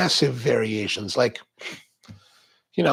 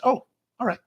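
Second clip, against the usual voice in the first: normal talking voice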